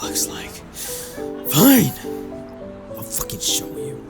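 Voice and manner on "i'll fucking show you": low, soft angry mutter; muttered resolve. Acted. Fed-up man agreeing to show the boy, muttering. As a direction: mutter it under your breath.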